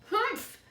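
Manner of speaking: loud, high scornful humph; old woman's humph. A narrator's reading. Old woman scoffing at Edward, loud high humph. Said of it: "she said again".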